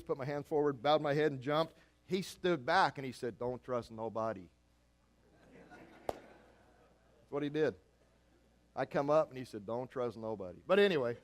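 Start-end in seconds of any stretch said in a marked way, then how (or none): 3.4-4.5 dad's voice. Retold or imitated. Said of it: "in that same voice". 9.7-10.6 dad's voice. Retold or imitated. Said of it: "in that same voice".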